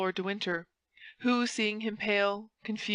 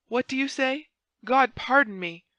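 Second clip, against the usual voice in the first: raised, louder indignant exclamation; crying out; 5 semitones higher, 5 dB louder than usual. A narrator's reading. Buckingham reacts to Felton's warning, crying out. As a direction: cry it out, higher than usual. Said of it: "cried Buckingham".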